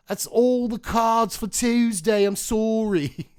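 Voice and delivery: loud, high, slow made-up British voice; fake British accent. Apologising to viewers in a hammed-up fake British accent. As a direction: overdo a British accent that exists nowhere. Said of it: "British accents that aren't actually British accents".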